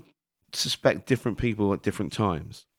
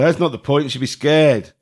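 emphatic retort; 6 semitones higher, 7 dB louder than usual. Retold or imitated. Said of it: "I said".

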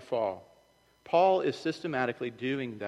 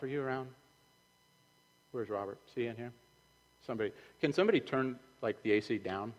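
ordinary talk

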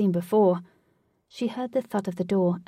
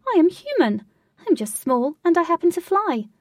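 high, loud little voice objecting; indignant protest; 8 semitones higher, 8 dB louder than usual. A narrator's reading. Tiny flying lady insisting she is human, indignant. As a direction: protest it, pitch leaping up. Small lady denies being fairy.

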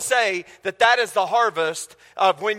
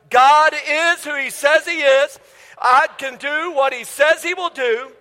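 slow, raised-pitch congregational recitation; group declaration; 4 semitones higher, slower than usual. Real, not acted. Pastor leading the church in a shared declaration, slow and emphatic. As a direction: declare it firmly, drawing each word out for all to follow.

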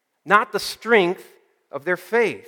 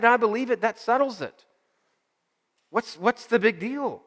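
higher, sure-of-himself friend brushing off worry; confident friend; 5 semitones higher than usual. Acted. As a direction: sound untroubled and certain, pitched up. Confident friend voiced in story.

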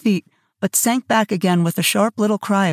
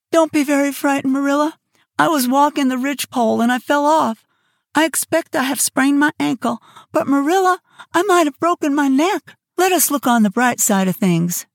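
Anne's voice; 6 semitones higher than usual. Acted. Hurt Anne reassuring Marilla.

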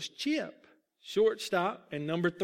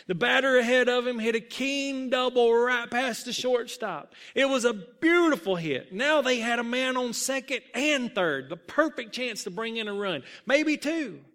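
high, loud, building hope; excited play narration; 6 semitones higher, 5 dB louder than usual. A narrator's reading. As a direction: narrate the rally with rising thrill. Narrating a rally in the game.

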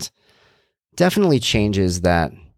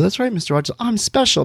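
put-on naive kid; 12 semitones higher than usual. Child answering Mister Rogers.

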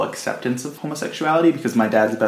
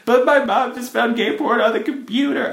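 mock crying; 13 semitones higher than usual. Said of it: "I don't know why I sound like crying".

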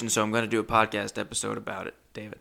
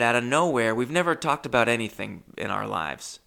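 ordinary talk

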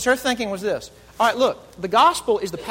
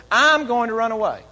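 defiant bunny; 5 semitones higher, 6 dB louder than usual. Retold or imitated. Little bunny in children's story.

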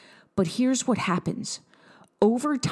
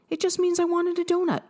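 bright reassurance; 11 semitones higher than usual. Acted. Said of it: "self-talk, kind conclusion".

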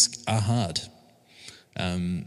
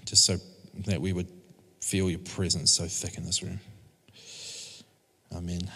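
prayer; 3 semitones lower than usual. Real, not acted. Opening prayer before the sermon.

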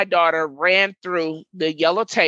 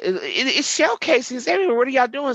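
alarmed outburst; 6 semitones higher, faster than usual. Retold or imitated. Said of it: "I told the officers that".

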